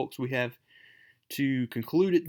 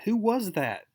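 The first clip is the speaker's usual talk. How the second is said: high, startled ask; sudden worried question; Mary Jane startled, asking Spider-Man, high and alarmed; 8 semitones higher, 3 dB louder than usual. Acted.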